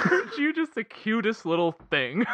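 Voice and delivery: put-on high laughing coo at a child; cooing baby talk. Echoing the cooing villain.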